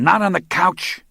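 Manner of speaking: loud, high angry shout; yelling. A narrator's reading. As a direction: bark it at the soaked guy. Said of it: "Moran yelled at him".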